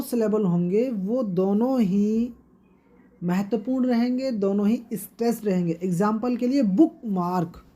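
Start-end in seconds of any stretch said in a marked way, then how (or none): none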